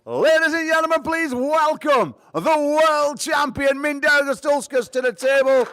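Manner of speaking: high, loud, slow booming introduction; ring announcer call. Acted. Announcer welcomes the world champion, high and booming. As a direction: stretch every word out for the crowd.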